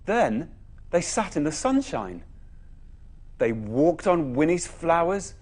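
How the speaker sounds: plain reading voice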